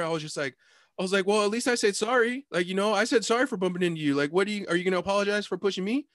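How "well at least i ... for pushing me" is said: high-pitched, insistent reenacted protest; politely argumentative. Teller arguing with the woman who shoved him, politely insistent. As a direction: press for an apology in a raised pitch. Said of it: "being super polite about it, but arguing".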